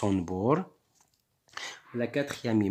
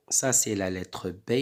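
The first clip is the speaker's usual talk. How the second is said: ordinary delivery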